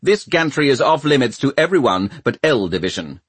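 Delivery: indignant official voice; snapped reprimand. A narrator's reading. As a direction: snap out the rule at trespassers. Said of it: "he snapped".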